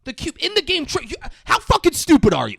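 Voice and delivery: loud exasperated outburst at the co-host; mock outrage. Real, not acted. Mock angry yell at co-host for the wrong answer. Host yelling at co-host's guess.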